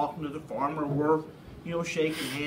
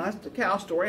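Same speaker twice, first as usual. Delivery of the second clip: usual speaking voice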